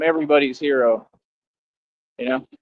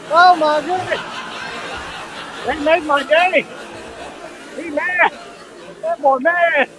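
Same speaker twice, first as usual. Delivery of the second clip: gleeful gloating; 12 semitones higher than usual. Real, not acted. Streamer gloats at viewer who paid ten dollars, high giddy crowing. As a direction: rub it in with a giddy cackling voice.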